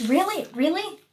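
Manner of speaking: high incredulous really; exasperated disbelief. Real, not acted. Talking to the cat on her paper, incredulous.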